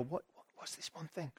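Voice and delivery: a soft, inward murmur of puzzlement; puzzled murmur. Retold or imitated. Martha lying on her bed, puzzling quietly to herself. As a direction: mutter it to yourself, half-asleep, trying to work it out. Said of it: "her brain ticking over".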